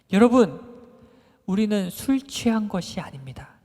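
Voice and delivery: calls out to the crowd, loud and firm; Peter's loud declaration. Retold or imitated. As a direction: proclaim it loudly so the whole crowd hears. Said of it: "이렇게 말했어요". (군중에게 외치는 베드로, 크고 단호하게)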